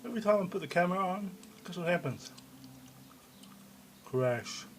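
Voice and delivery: ordinary delivery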